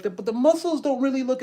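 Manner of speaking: high, loud complaining whine; whiny protest. Acted. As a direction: moan it like an impatient gym-goer. Voicing a complaining gym-goer.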